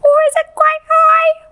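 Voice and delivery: squeaky high demo voice. Shown as a demonstration. Teacher showing children a high voice. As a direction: jump right up high. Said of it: "what does the littlest billy goat gruff sound like".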